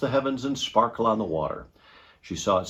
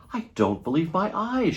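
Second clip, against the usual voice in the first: amazed cry; 10 semitones higher than usual. A narrator's reading. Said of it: "she cried".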